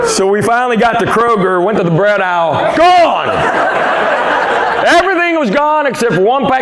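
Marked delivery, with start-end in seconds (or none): 2.8-6.6 hammed-up cry of dismay; dramatic exclamation. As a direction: belt it out in comic shock. Punchline exclamation about the empty bread aisle.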